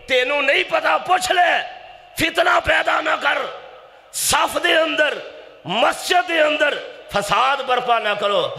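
high-pitched scolding shout; forceful, high preacher's cry; full-force preaching. Real, not acted. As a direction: admonish them at the top of the pulpit voice.

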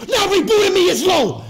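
loud, high-pitched protest; outraged, full-voice rant; angry ranting shout. Real, not acted. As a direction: yell each grievance like a tirade. Ranting back after being called low.

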